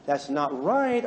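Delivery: low, slowing voice of conscience; gently scold, drawing out the final words; nagging conscience. Acted. Blue woman's conscience as she speeds, nagging. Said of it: "The old conscience kicks in".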